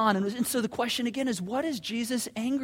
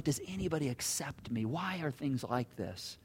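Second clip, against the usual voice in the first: despairing inner voice; 5 semitones lower, 8 dB quieter than usual. Retold or imitated. Leper's inner doubting thoughts.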